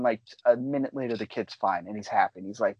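normal talking voice

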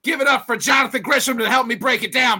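ordinary talk, nothing marked

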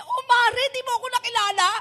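loud, high, delighted greeting; excited old friend. Retold or imitated. Old neighbour to the preacher, loud high excited voice. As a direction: burst out happily at a friend. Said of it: "Sabi niya".

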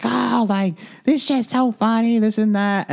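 imitating a drunk girl shrieking; screaming drunk girl. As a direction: mimic a drunk girl in a very high squeal. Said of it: "screaming outside on the deck".

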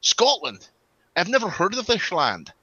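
imitating Sean Connery, incredulous; Sean Connery impression. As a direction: ham up a bewildered Connery. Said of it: "Sean Connery ... goes".

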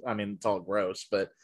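usual speaking voice

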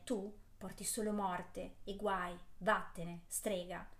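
ordinary delivery

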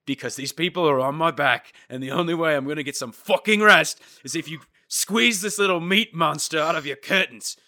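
hammed-up demanding husband to his wife; mock pushy husband. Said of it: "husband voiced demanding to wife".

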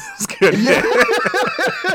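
very high, slow, laughing through it. Real, not acted. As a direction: squeal it out while cracking up. Repeating the line while laughing.

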